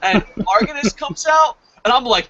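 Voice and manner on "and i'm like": normal talking voice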